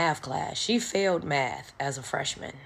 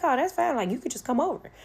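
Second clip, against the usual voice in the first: much higher, brushing it off brightly; eager sophomore girl; 9 semitones higher, faster than usual. Retold or imitated. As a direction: toss it off like it's no big deal.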